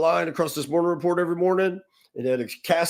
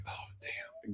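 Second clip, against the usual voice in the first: a whispered oh damn, caught out; whispered dread; 22 dB quieter than usual. Retold or imitated. Young manager hears his name called, whispering in dread. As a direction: breathe it out like a kid caught again. Summoned to boss's office again.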